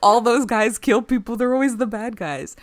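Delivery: loud, exasperated outburst; indignant rant. Retold or imitated. Acted written rant about admirals.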